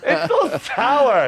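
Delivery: slow, laughing disgust; hammed-up mosquito complaint. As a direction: draw it out like a revolted bug.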